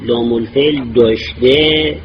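usual speaking voice

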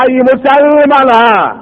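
high, slow call to the Muslims; shouted plea. Acted. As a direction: cry out to the crowd for mercy, drawn out. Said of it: "داد زد".

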